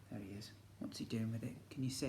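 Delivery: soft whisper to the class; whispered question. Real, not acted. Teacher whispers to the children, hushed.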